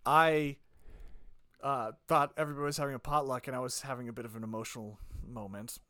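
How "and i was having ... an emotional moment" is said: low, soft, understated storytelling; subdued confession. Real, not acted. Confessing a missed work potluck to co-host, subdued. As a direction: keep it hushed and low, setting up the punchline.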